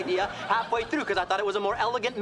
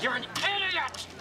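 idiot pitched up in frustration; high exasperated snap; 10 semitones higher than usual. Acted. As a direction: fling the insult indignantly. Repeated insult, louder.

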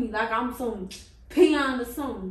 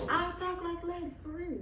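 higher, soft lady voice; quiet, higher-pitched feminine promise; ladylike voice; 5 semitones higher, 7 dB quieter than usual. Shown as a demonstration. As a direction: plead sweetly in a dainty voice. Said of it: "I won't put on my man voice".

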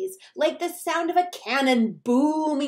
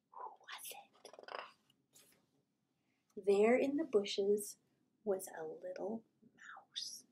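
hushed, softer whisper; suspense whisper; 4 semitones lower, 10 dB quieter than usual. A narrator's reading. The narrator building suspense for the listener, whispered. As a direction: lean in and whisper the reveal. Suspense before revealing the mouse.

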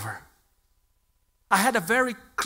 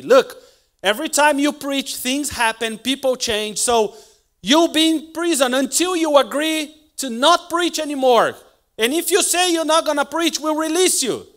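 loud, high, driving sermon voice; preaching at full force; 10 semitones higher, 10 dB louder than usual. Acted. Preacher urging the church in a loud, high sermon voice. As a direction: pound the message home from the pulpit.

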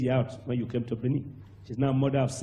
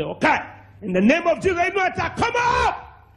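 shouted command; 7 dB louder than usual. Retold or imitated. Preacher commanding the dead child to rise, shouting.

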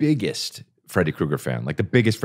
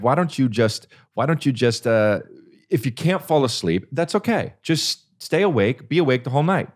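higher, gentle mock mom voice; reassuring mom; 6 semitones higher than usual. Mom reassuring scared son.

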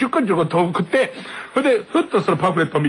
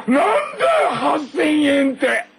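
笑いまじりの憤慨; 8 semitones higher, slower than usual. Retold or imitated. 八千円取られた憤慨.

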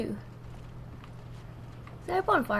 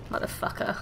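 ordinary delivery

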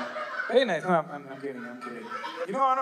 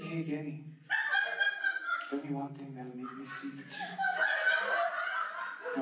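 put-on hushed, low come-on from a club girl; 5 semitones lower, 10 dB quieter than usual.

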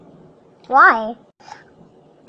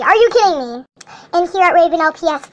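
ordinary talk

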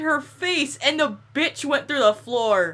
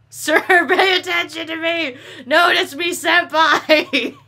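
shrill anime fangirl plea, louder; overacted anime dub; 4 semitones higher, 5 dB louder, slower than usual. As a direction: beg like a lovesick anime schoolgirl. Begging game NPC for attention.

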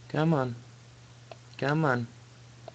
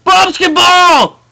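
drawn-out excited yell; excited shout; 18 dB louder, much slower than usual. Real, not acted. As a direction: shout it out slowly, thrilled. Game title called out excitedly.

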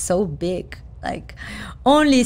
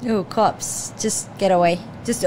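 ordinary talk, nothing marked

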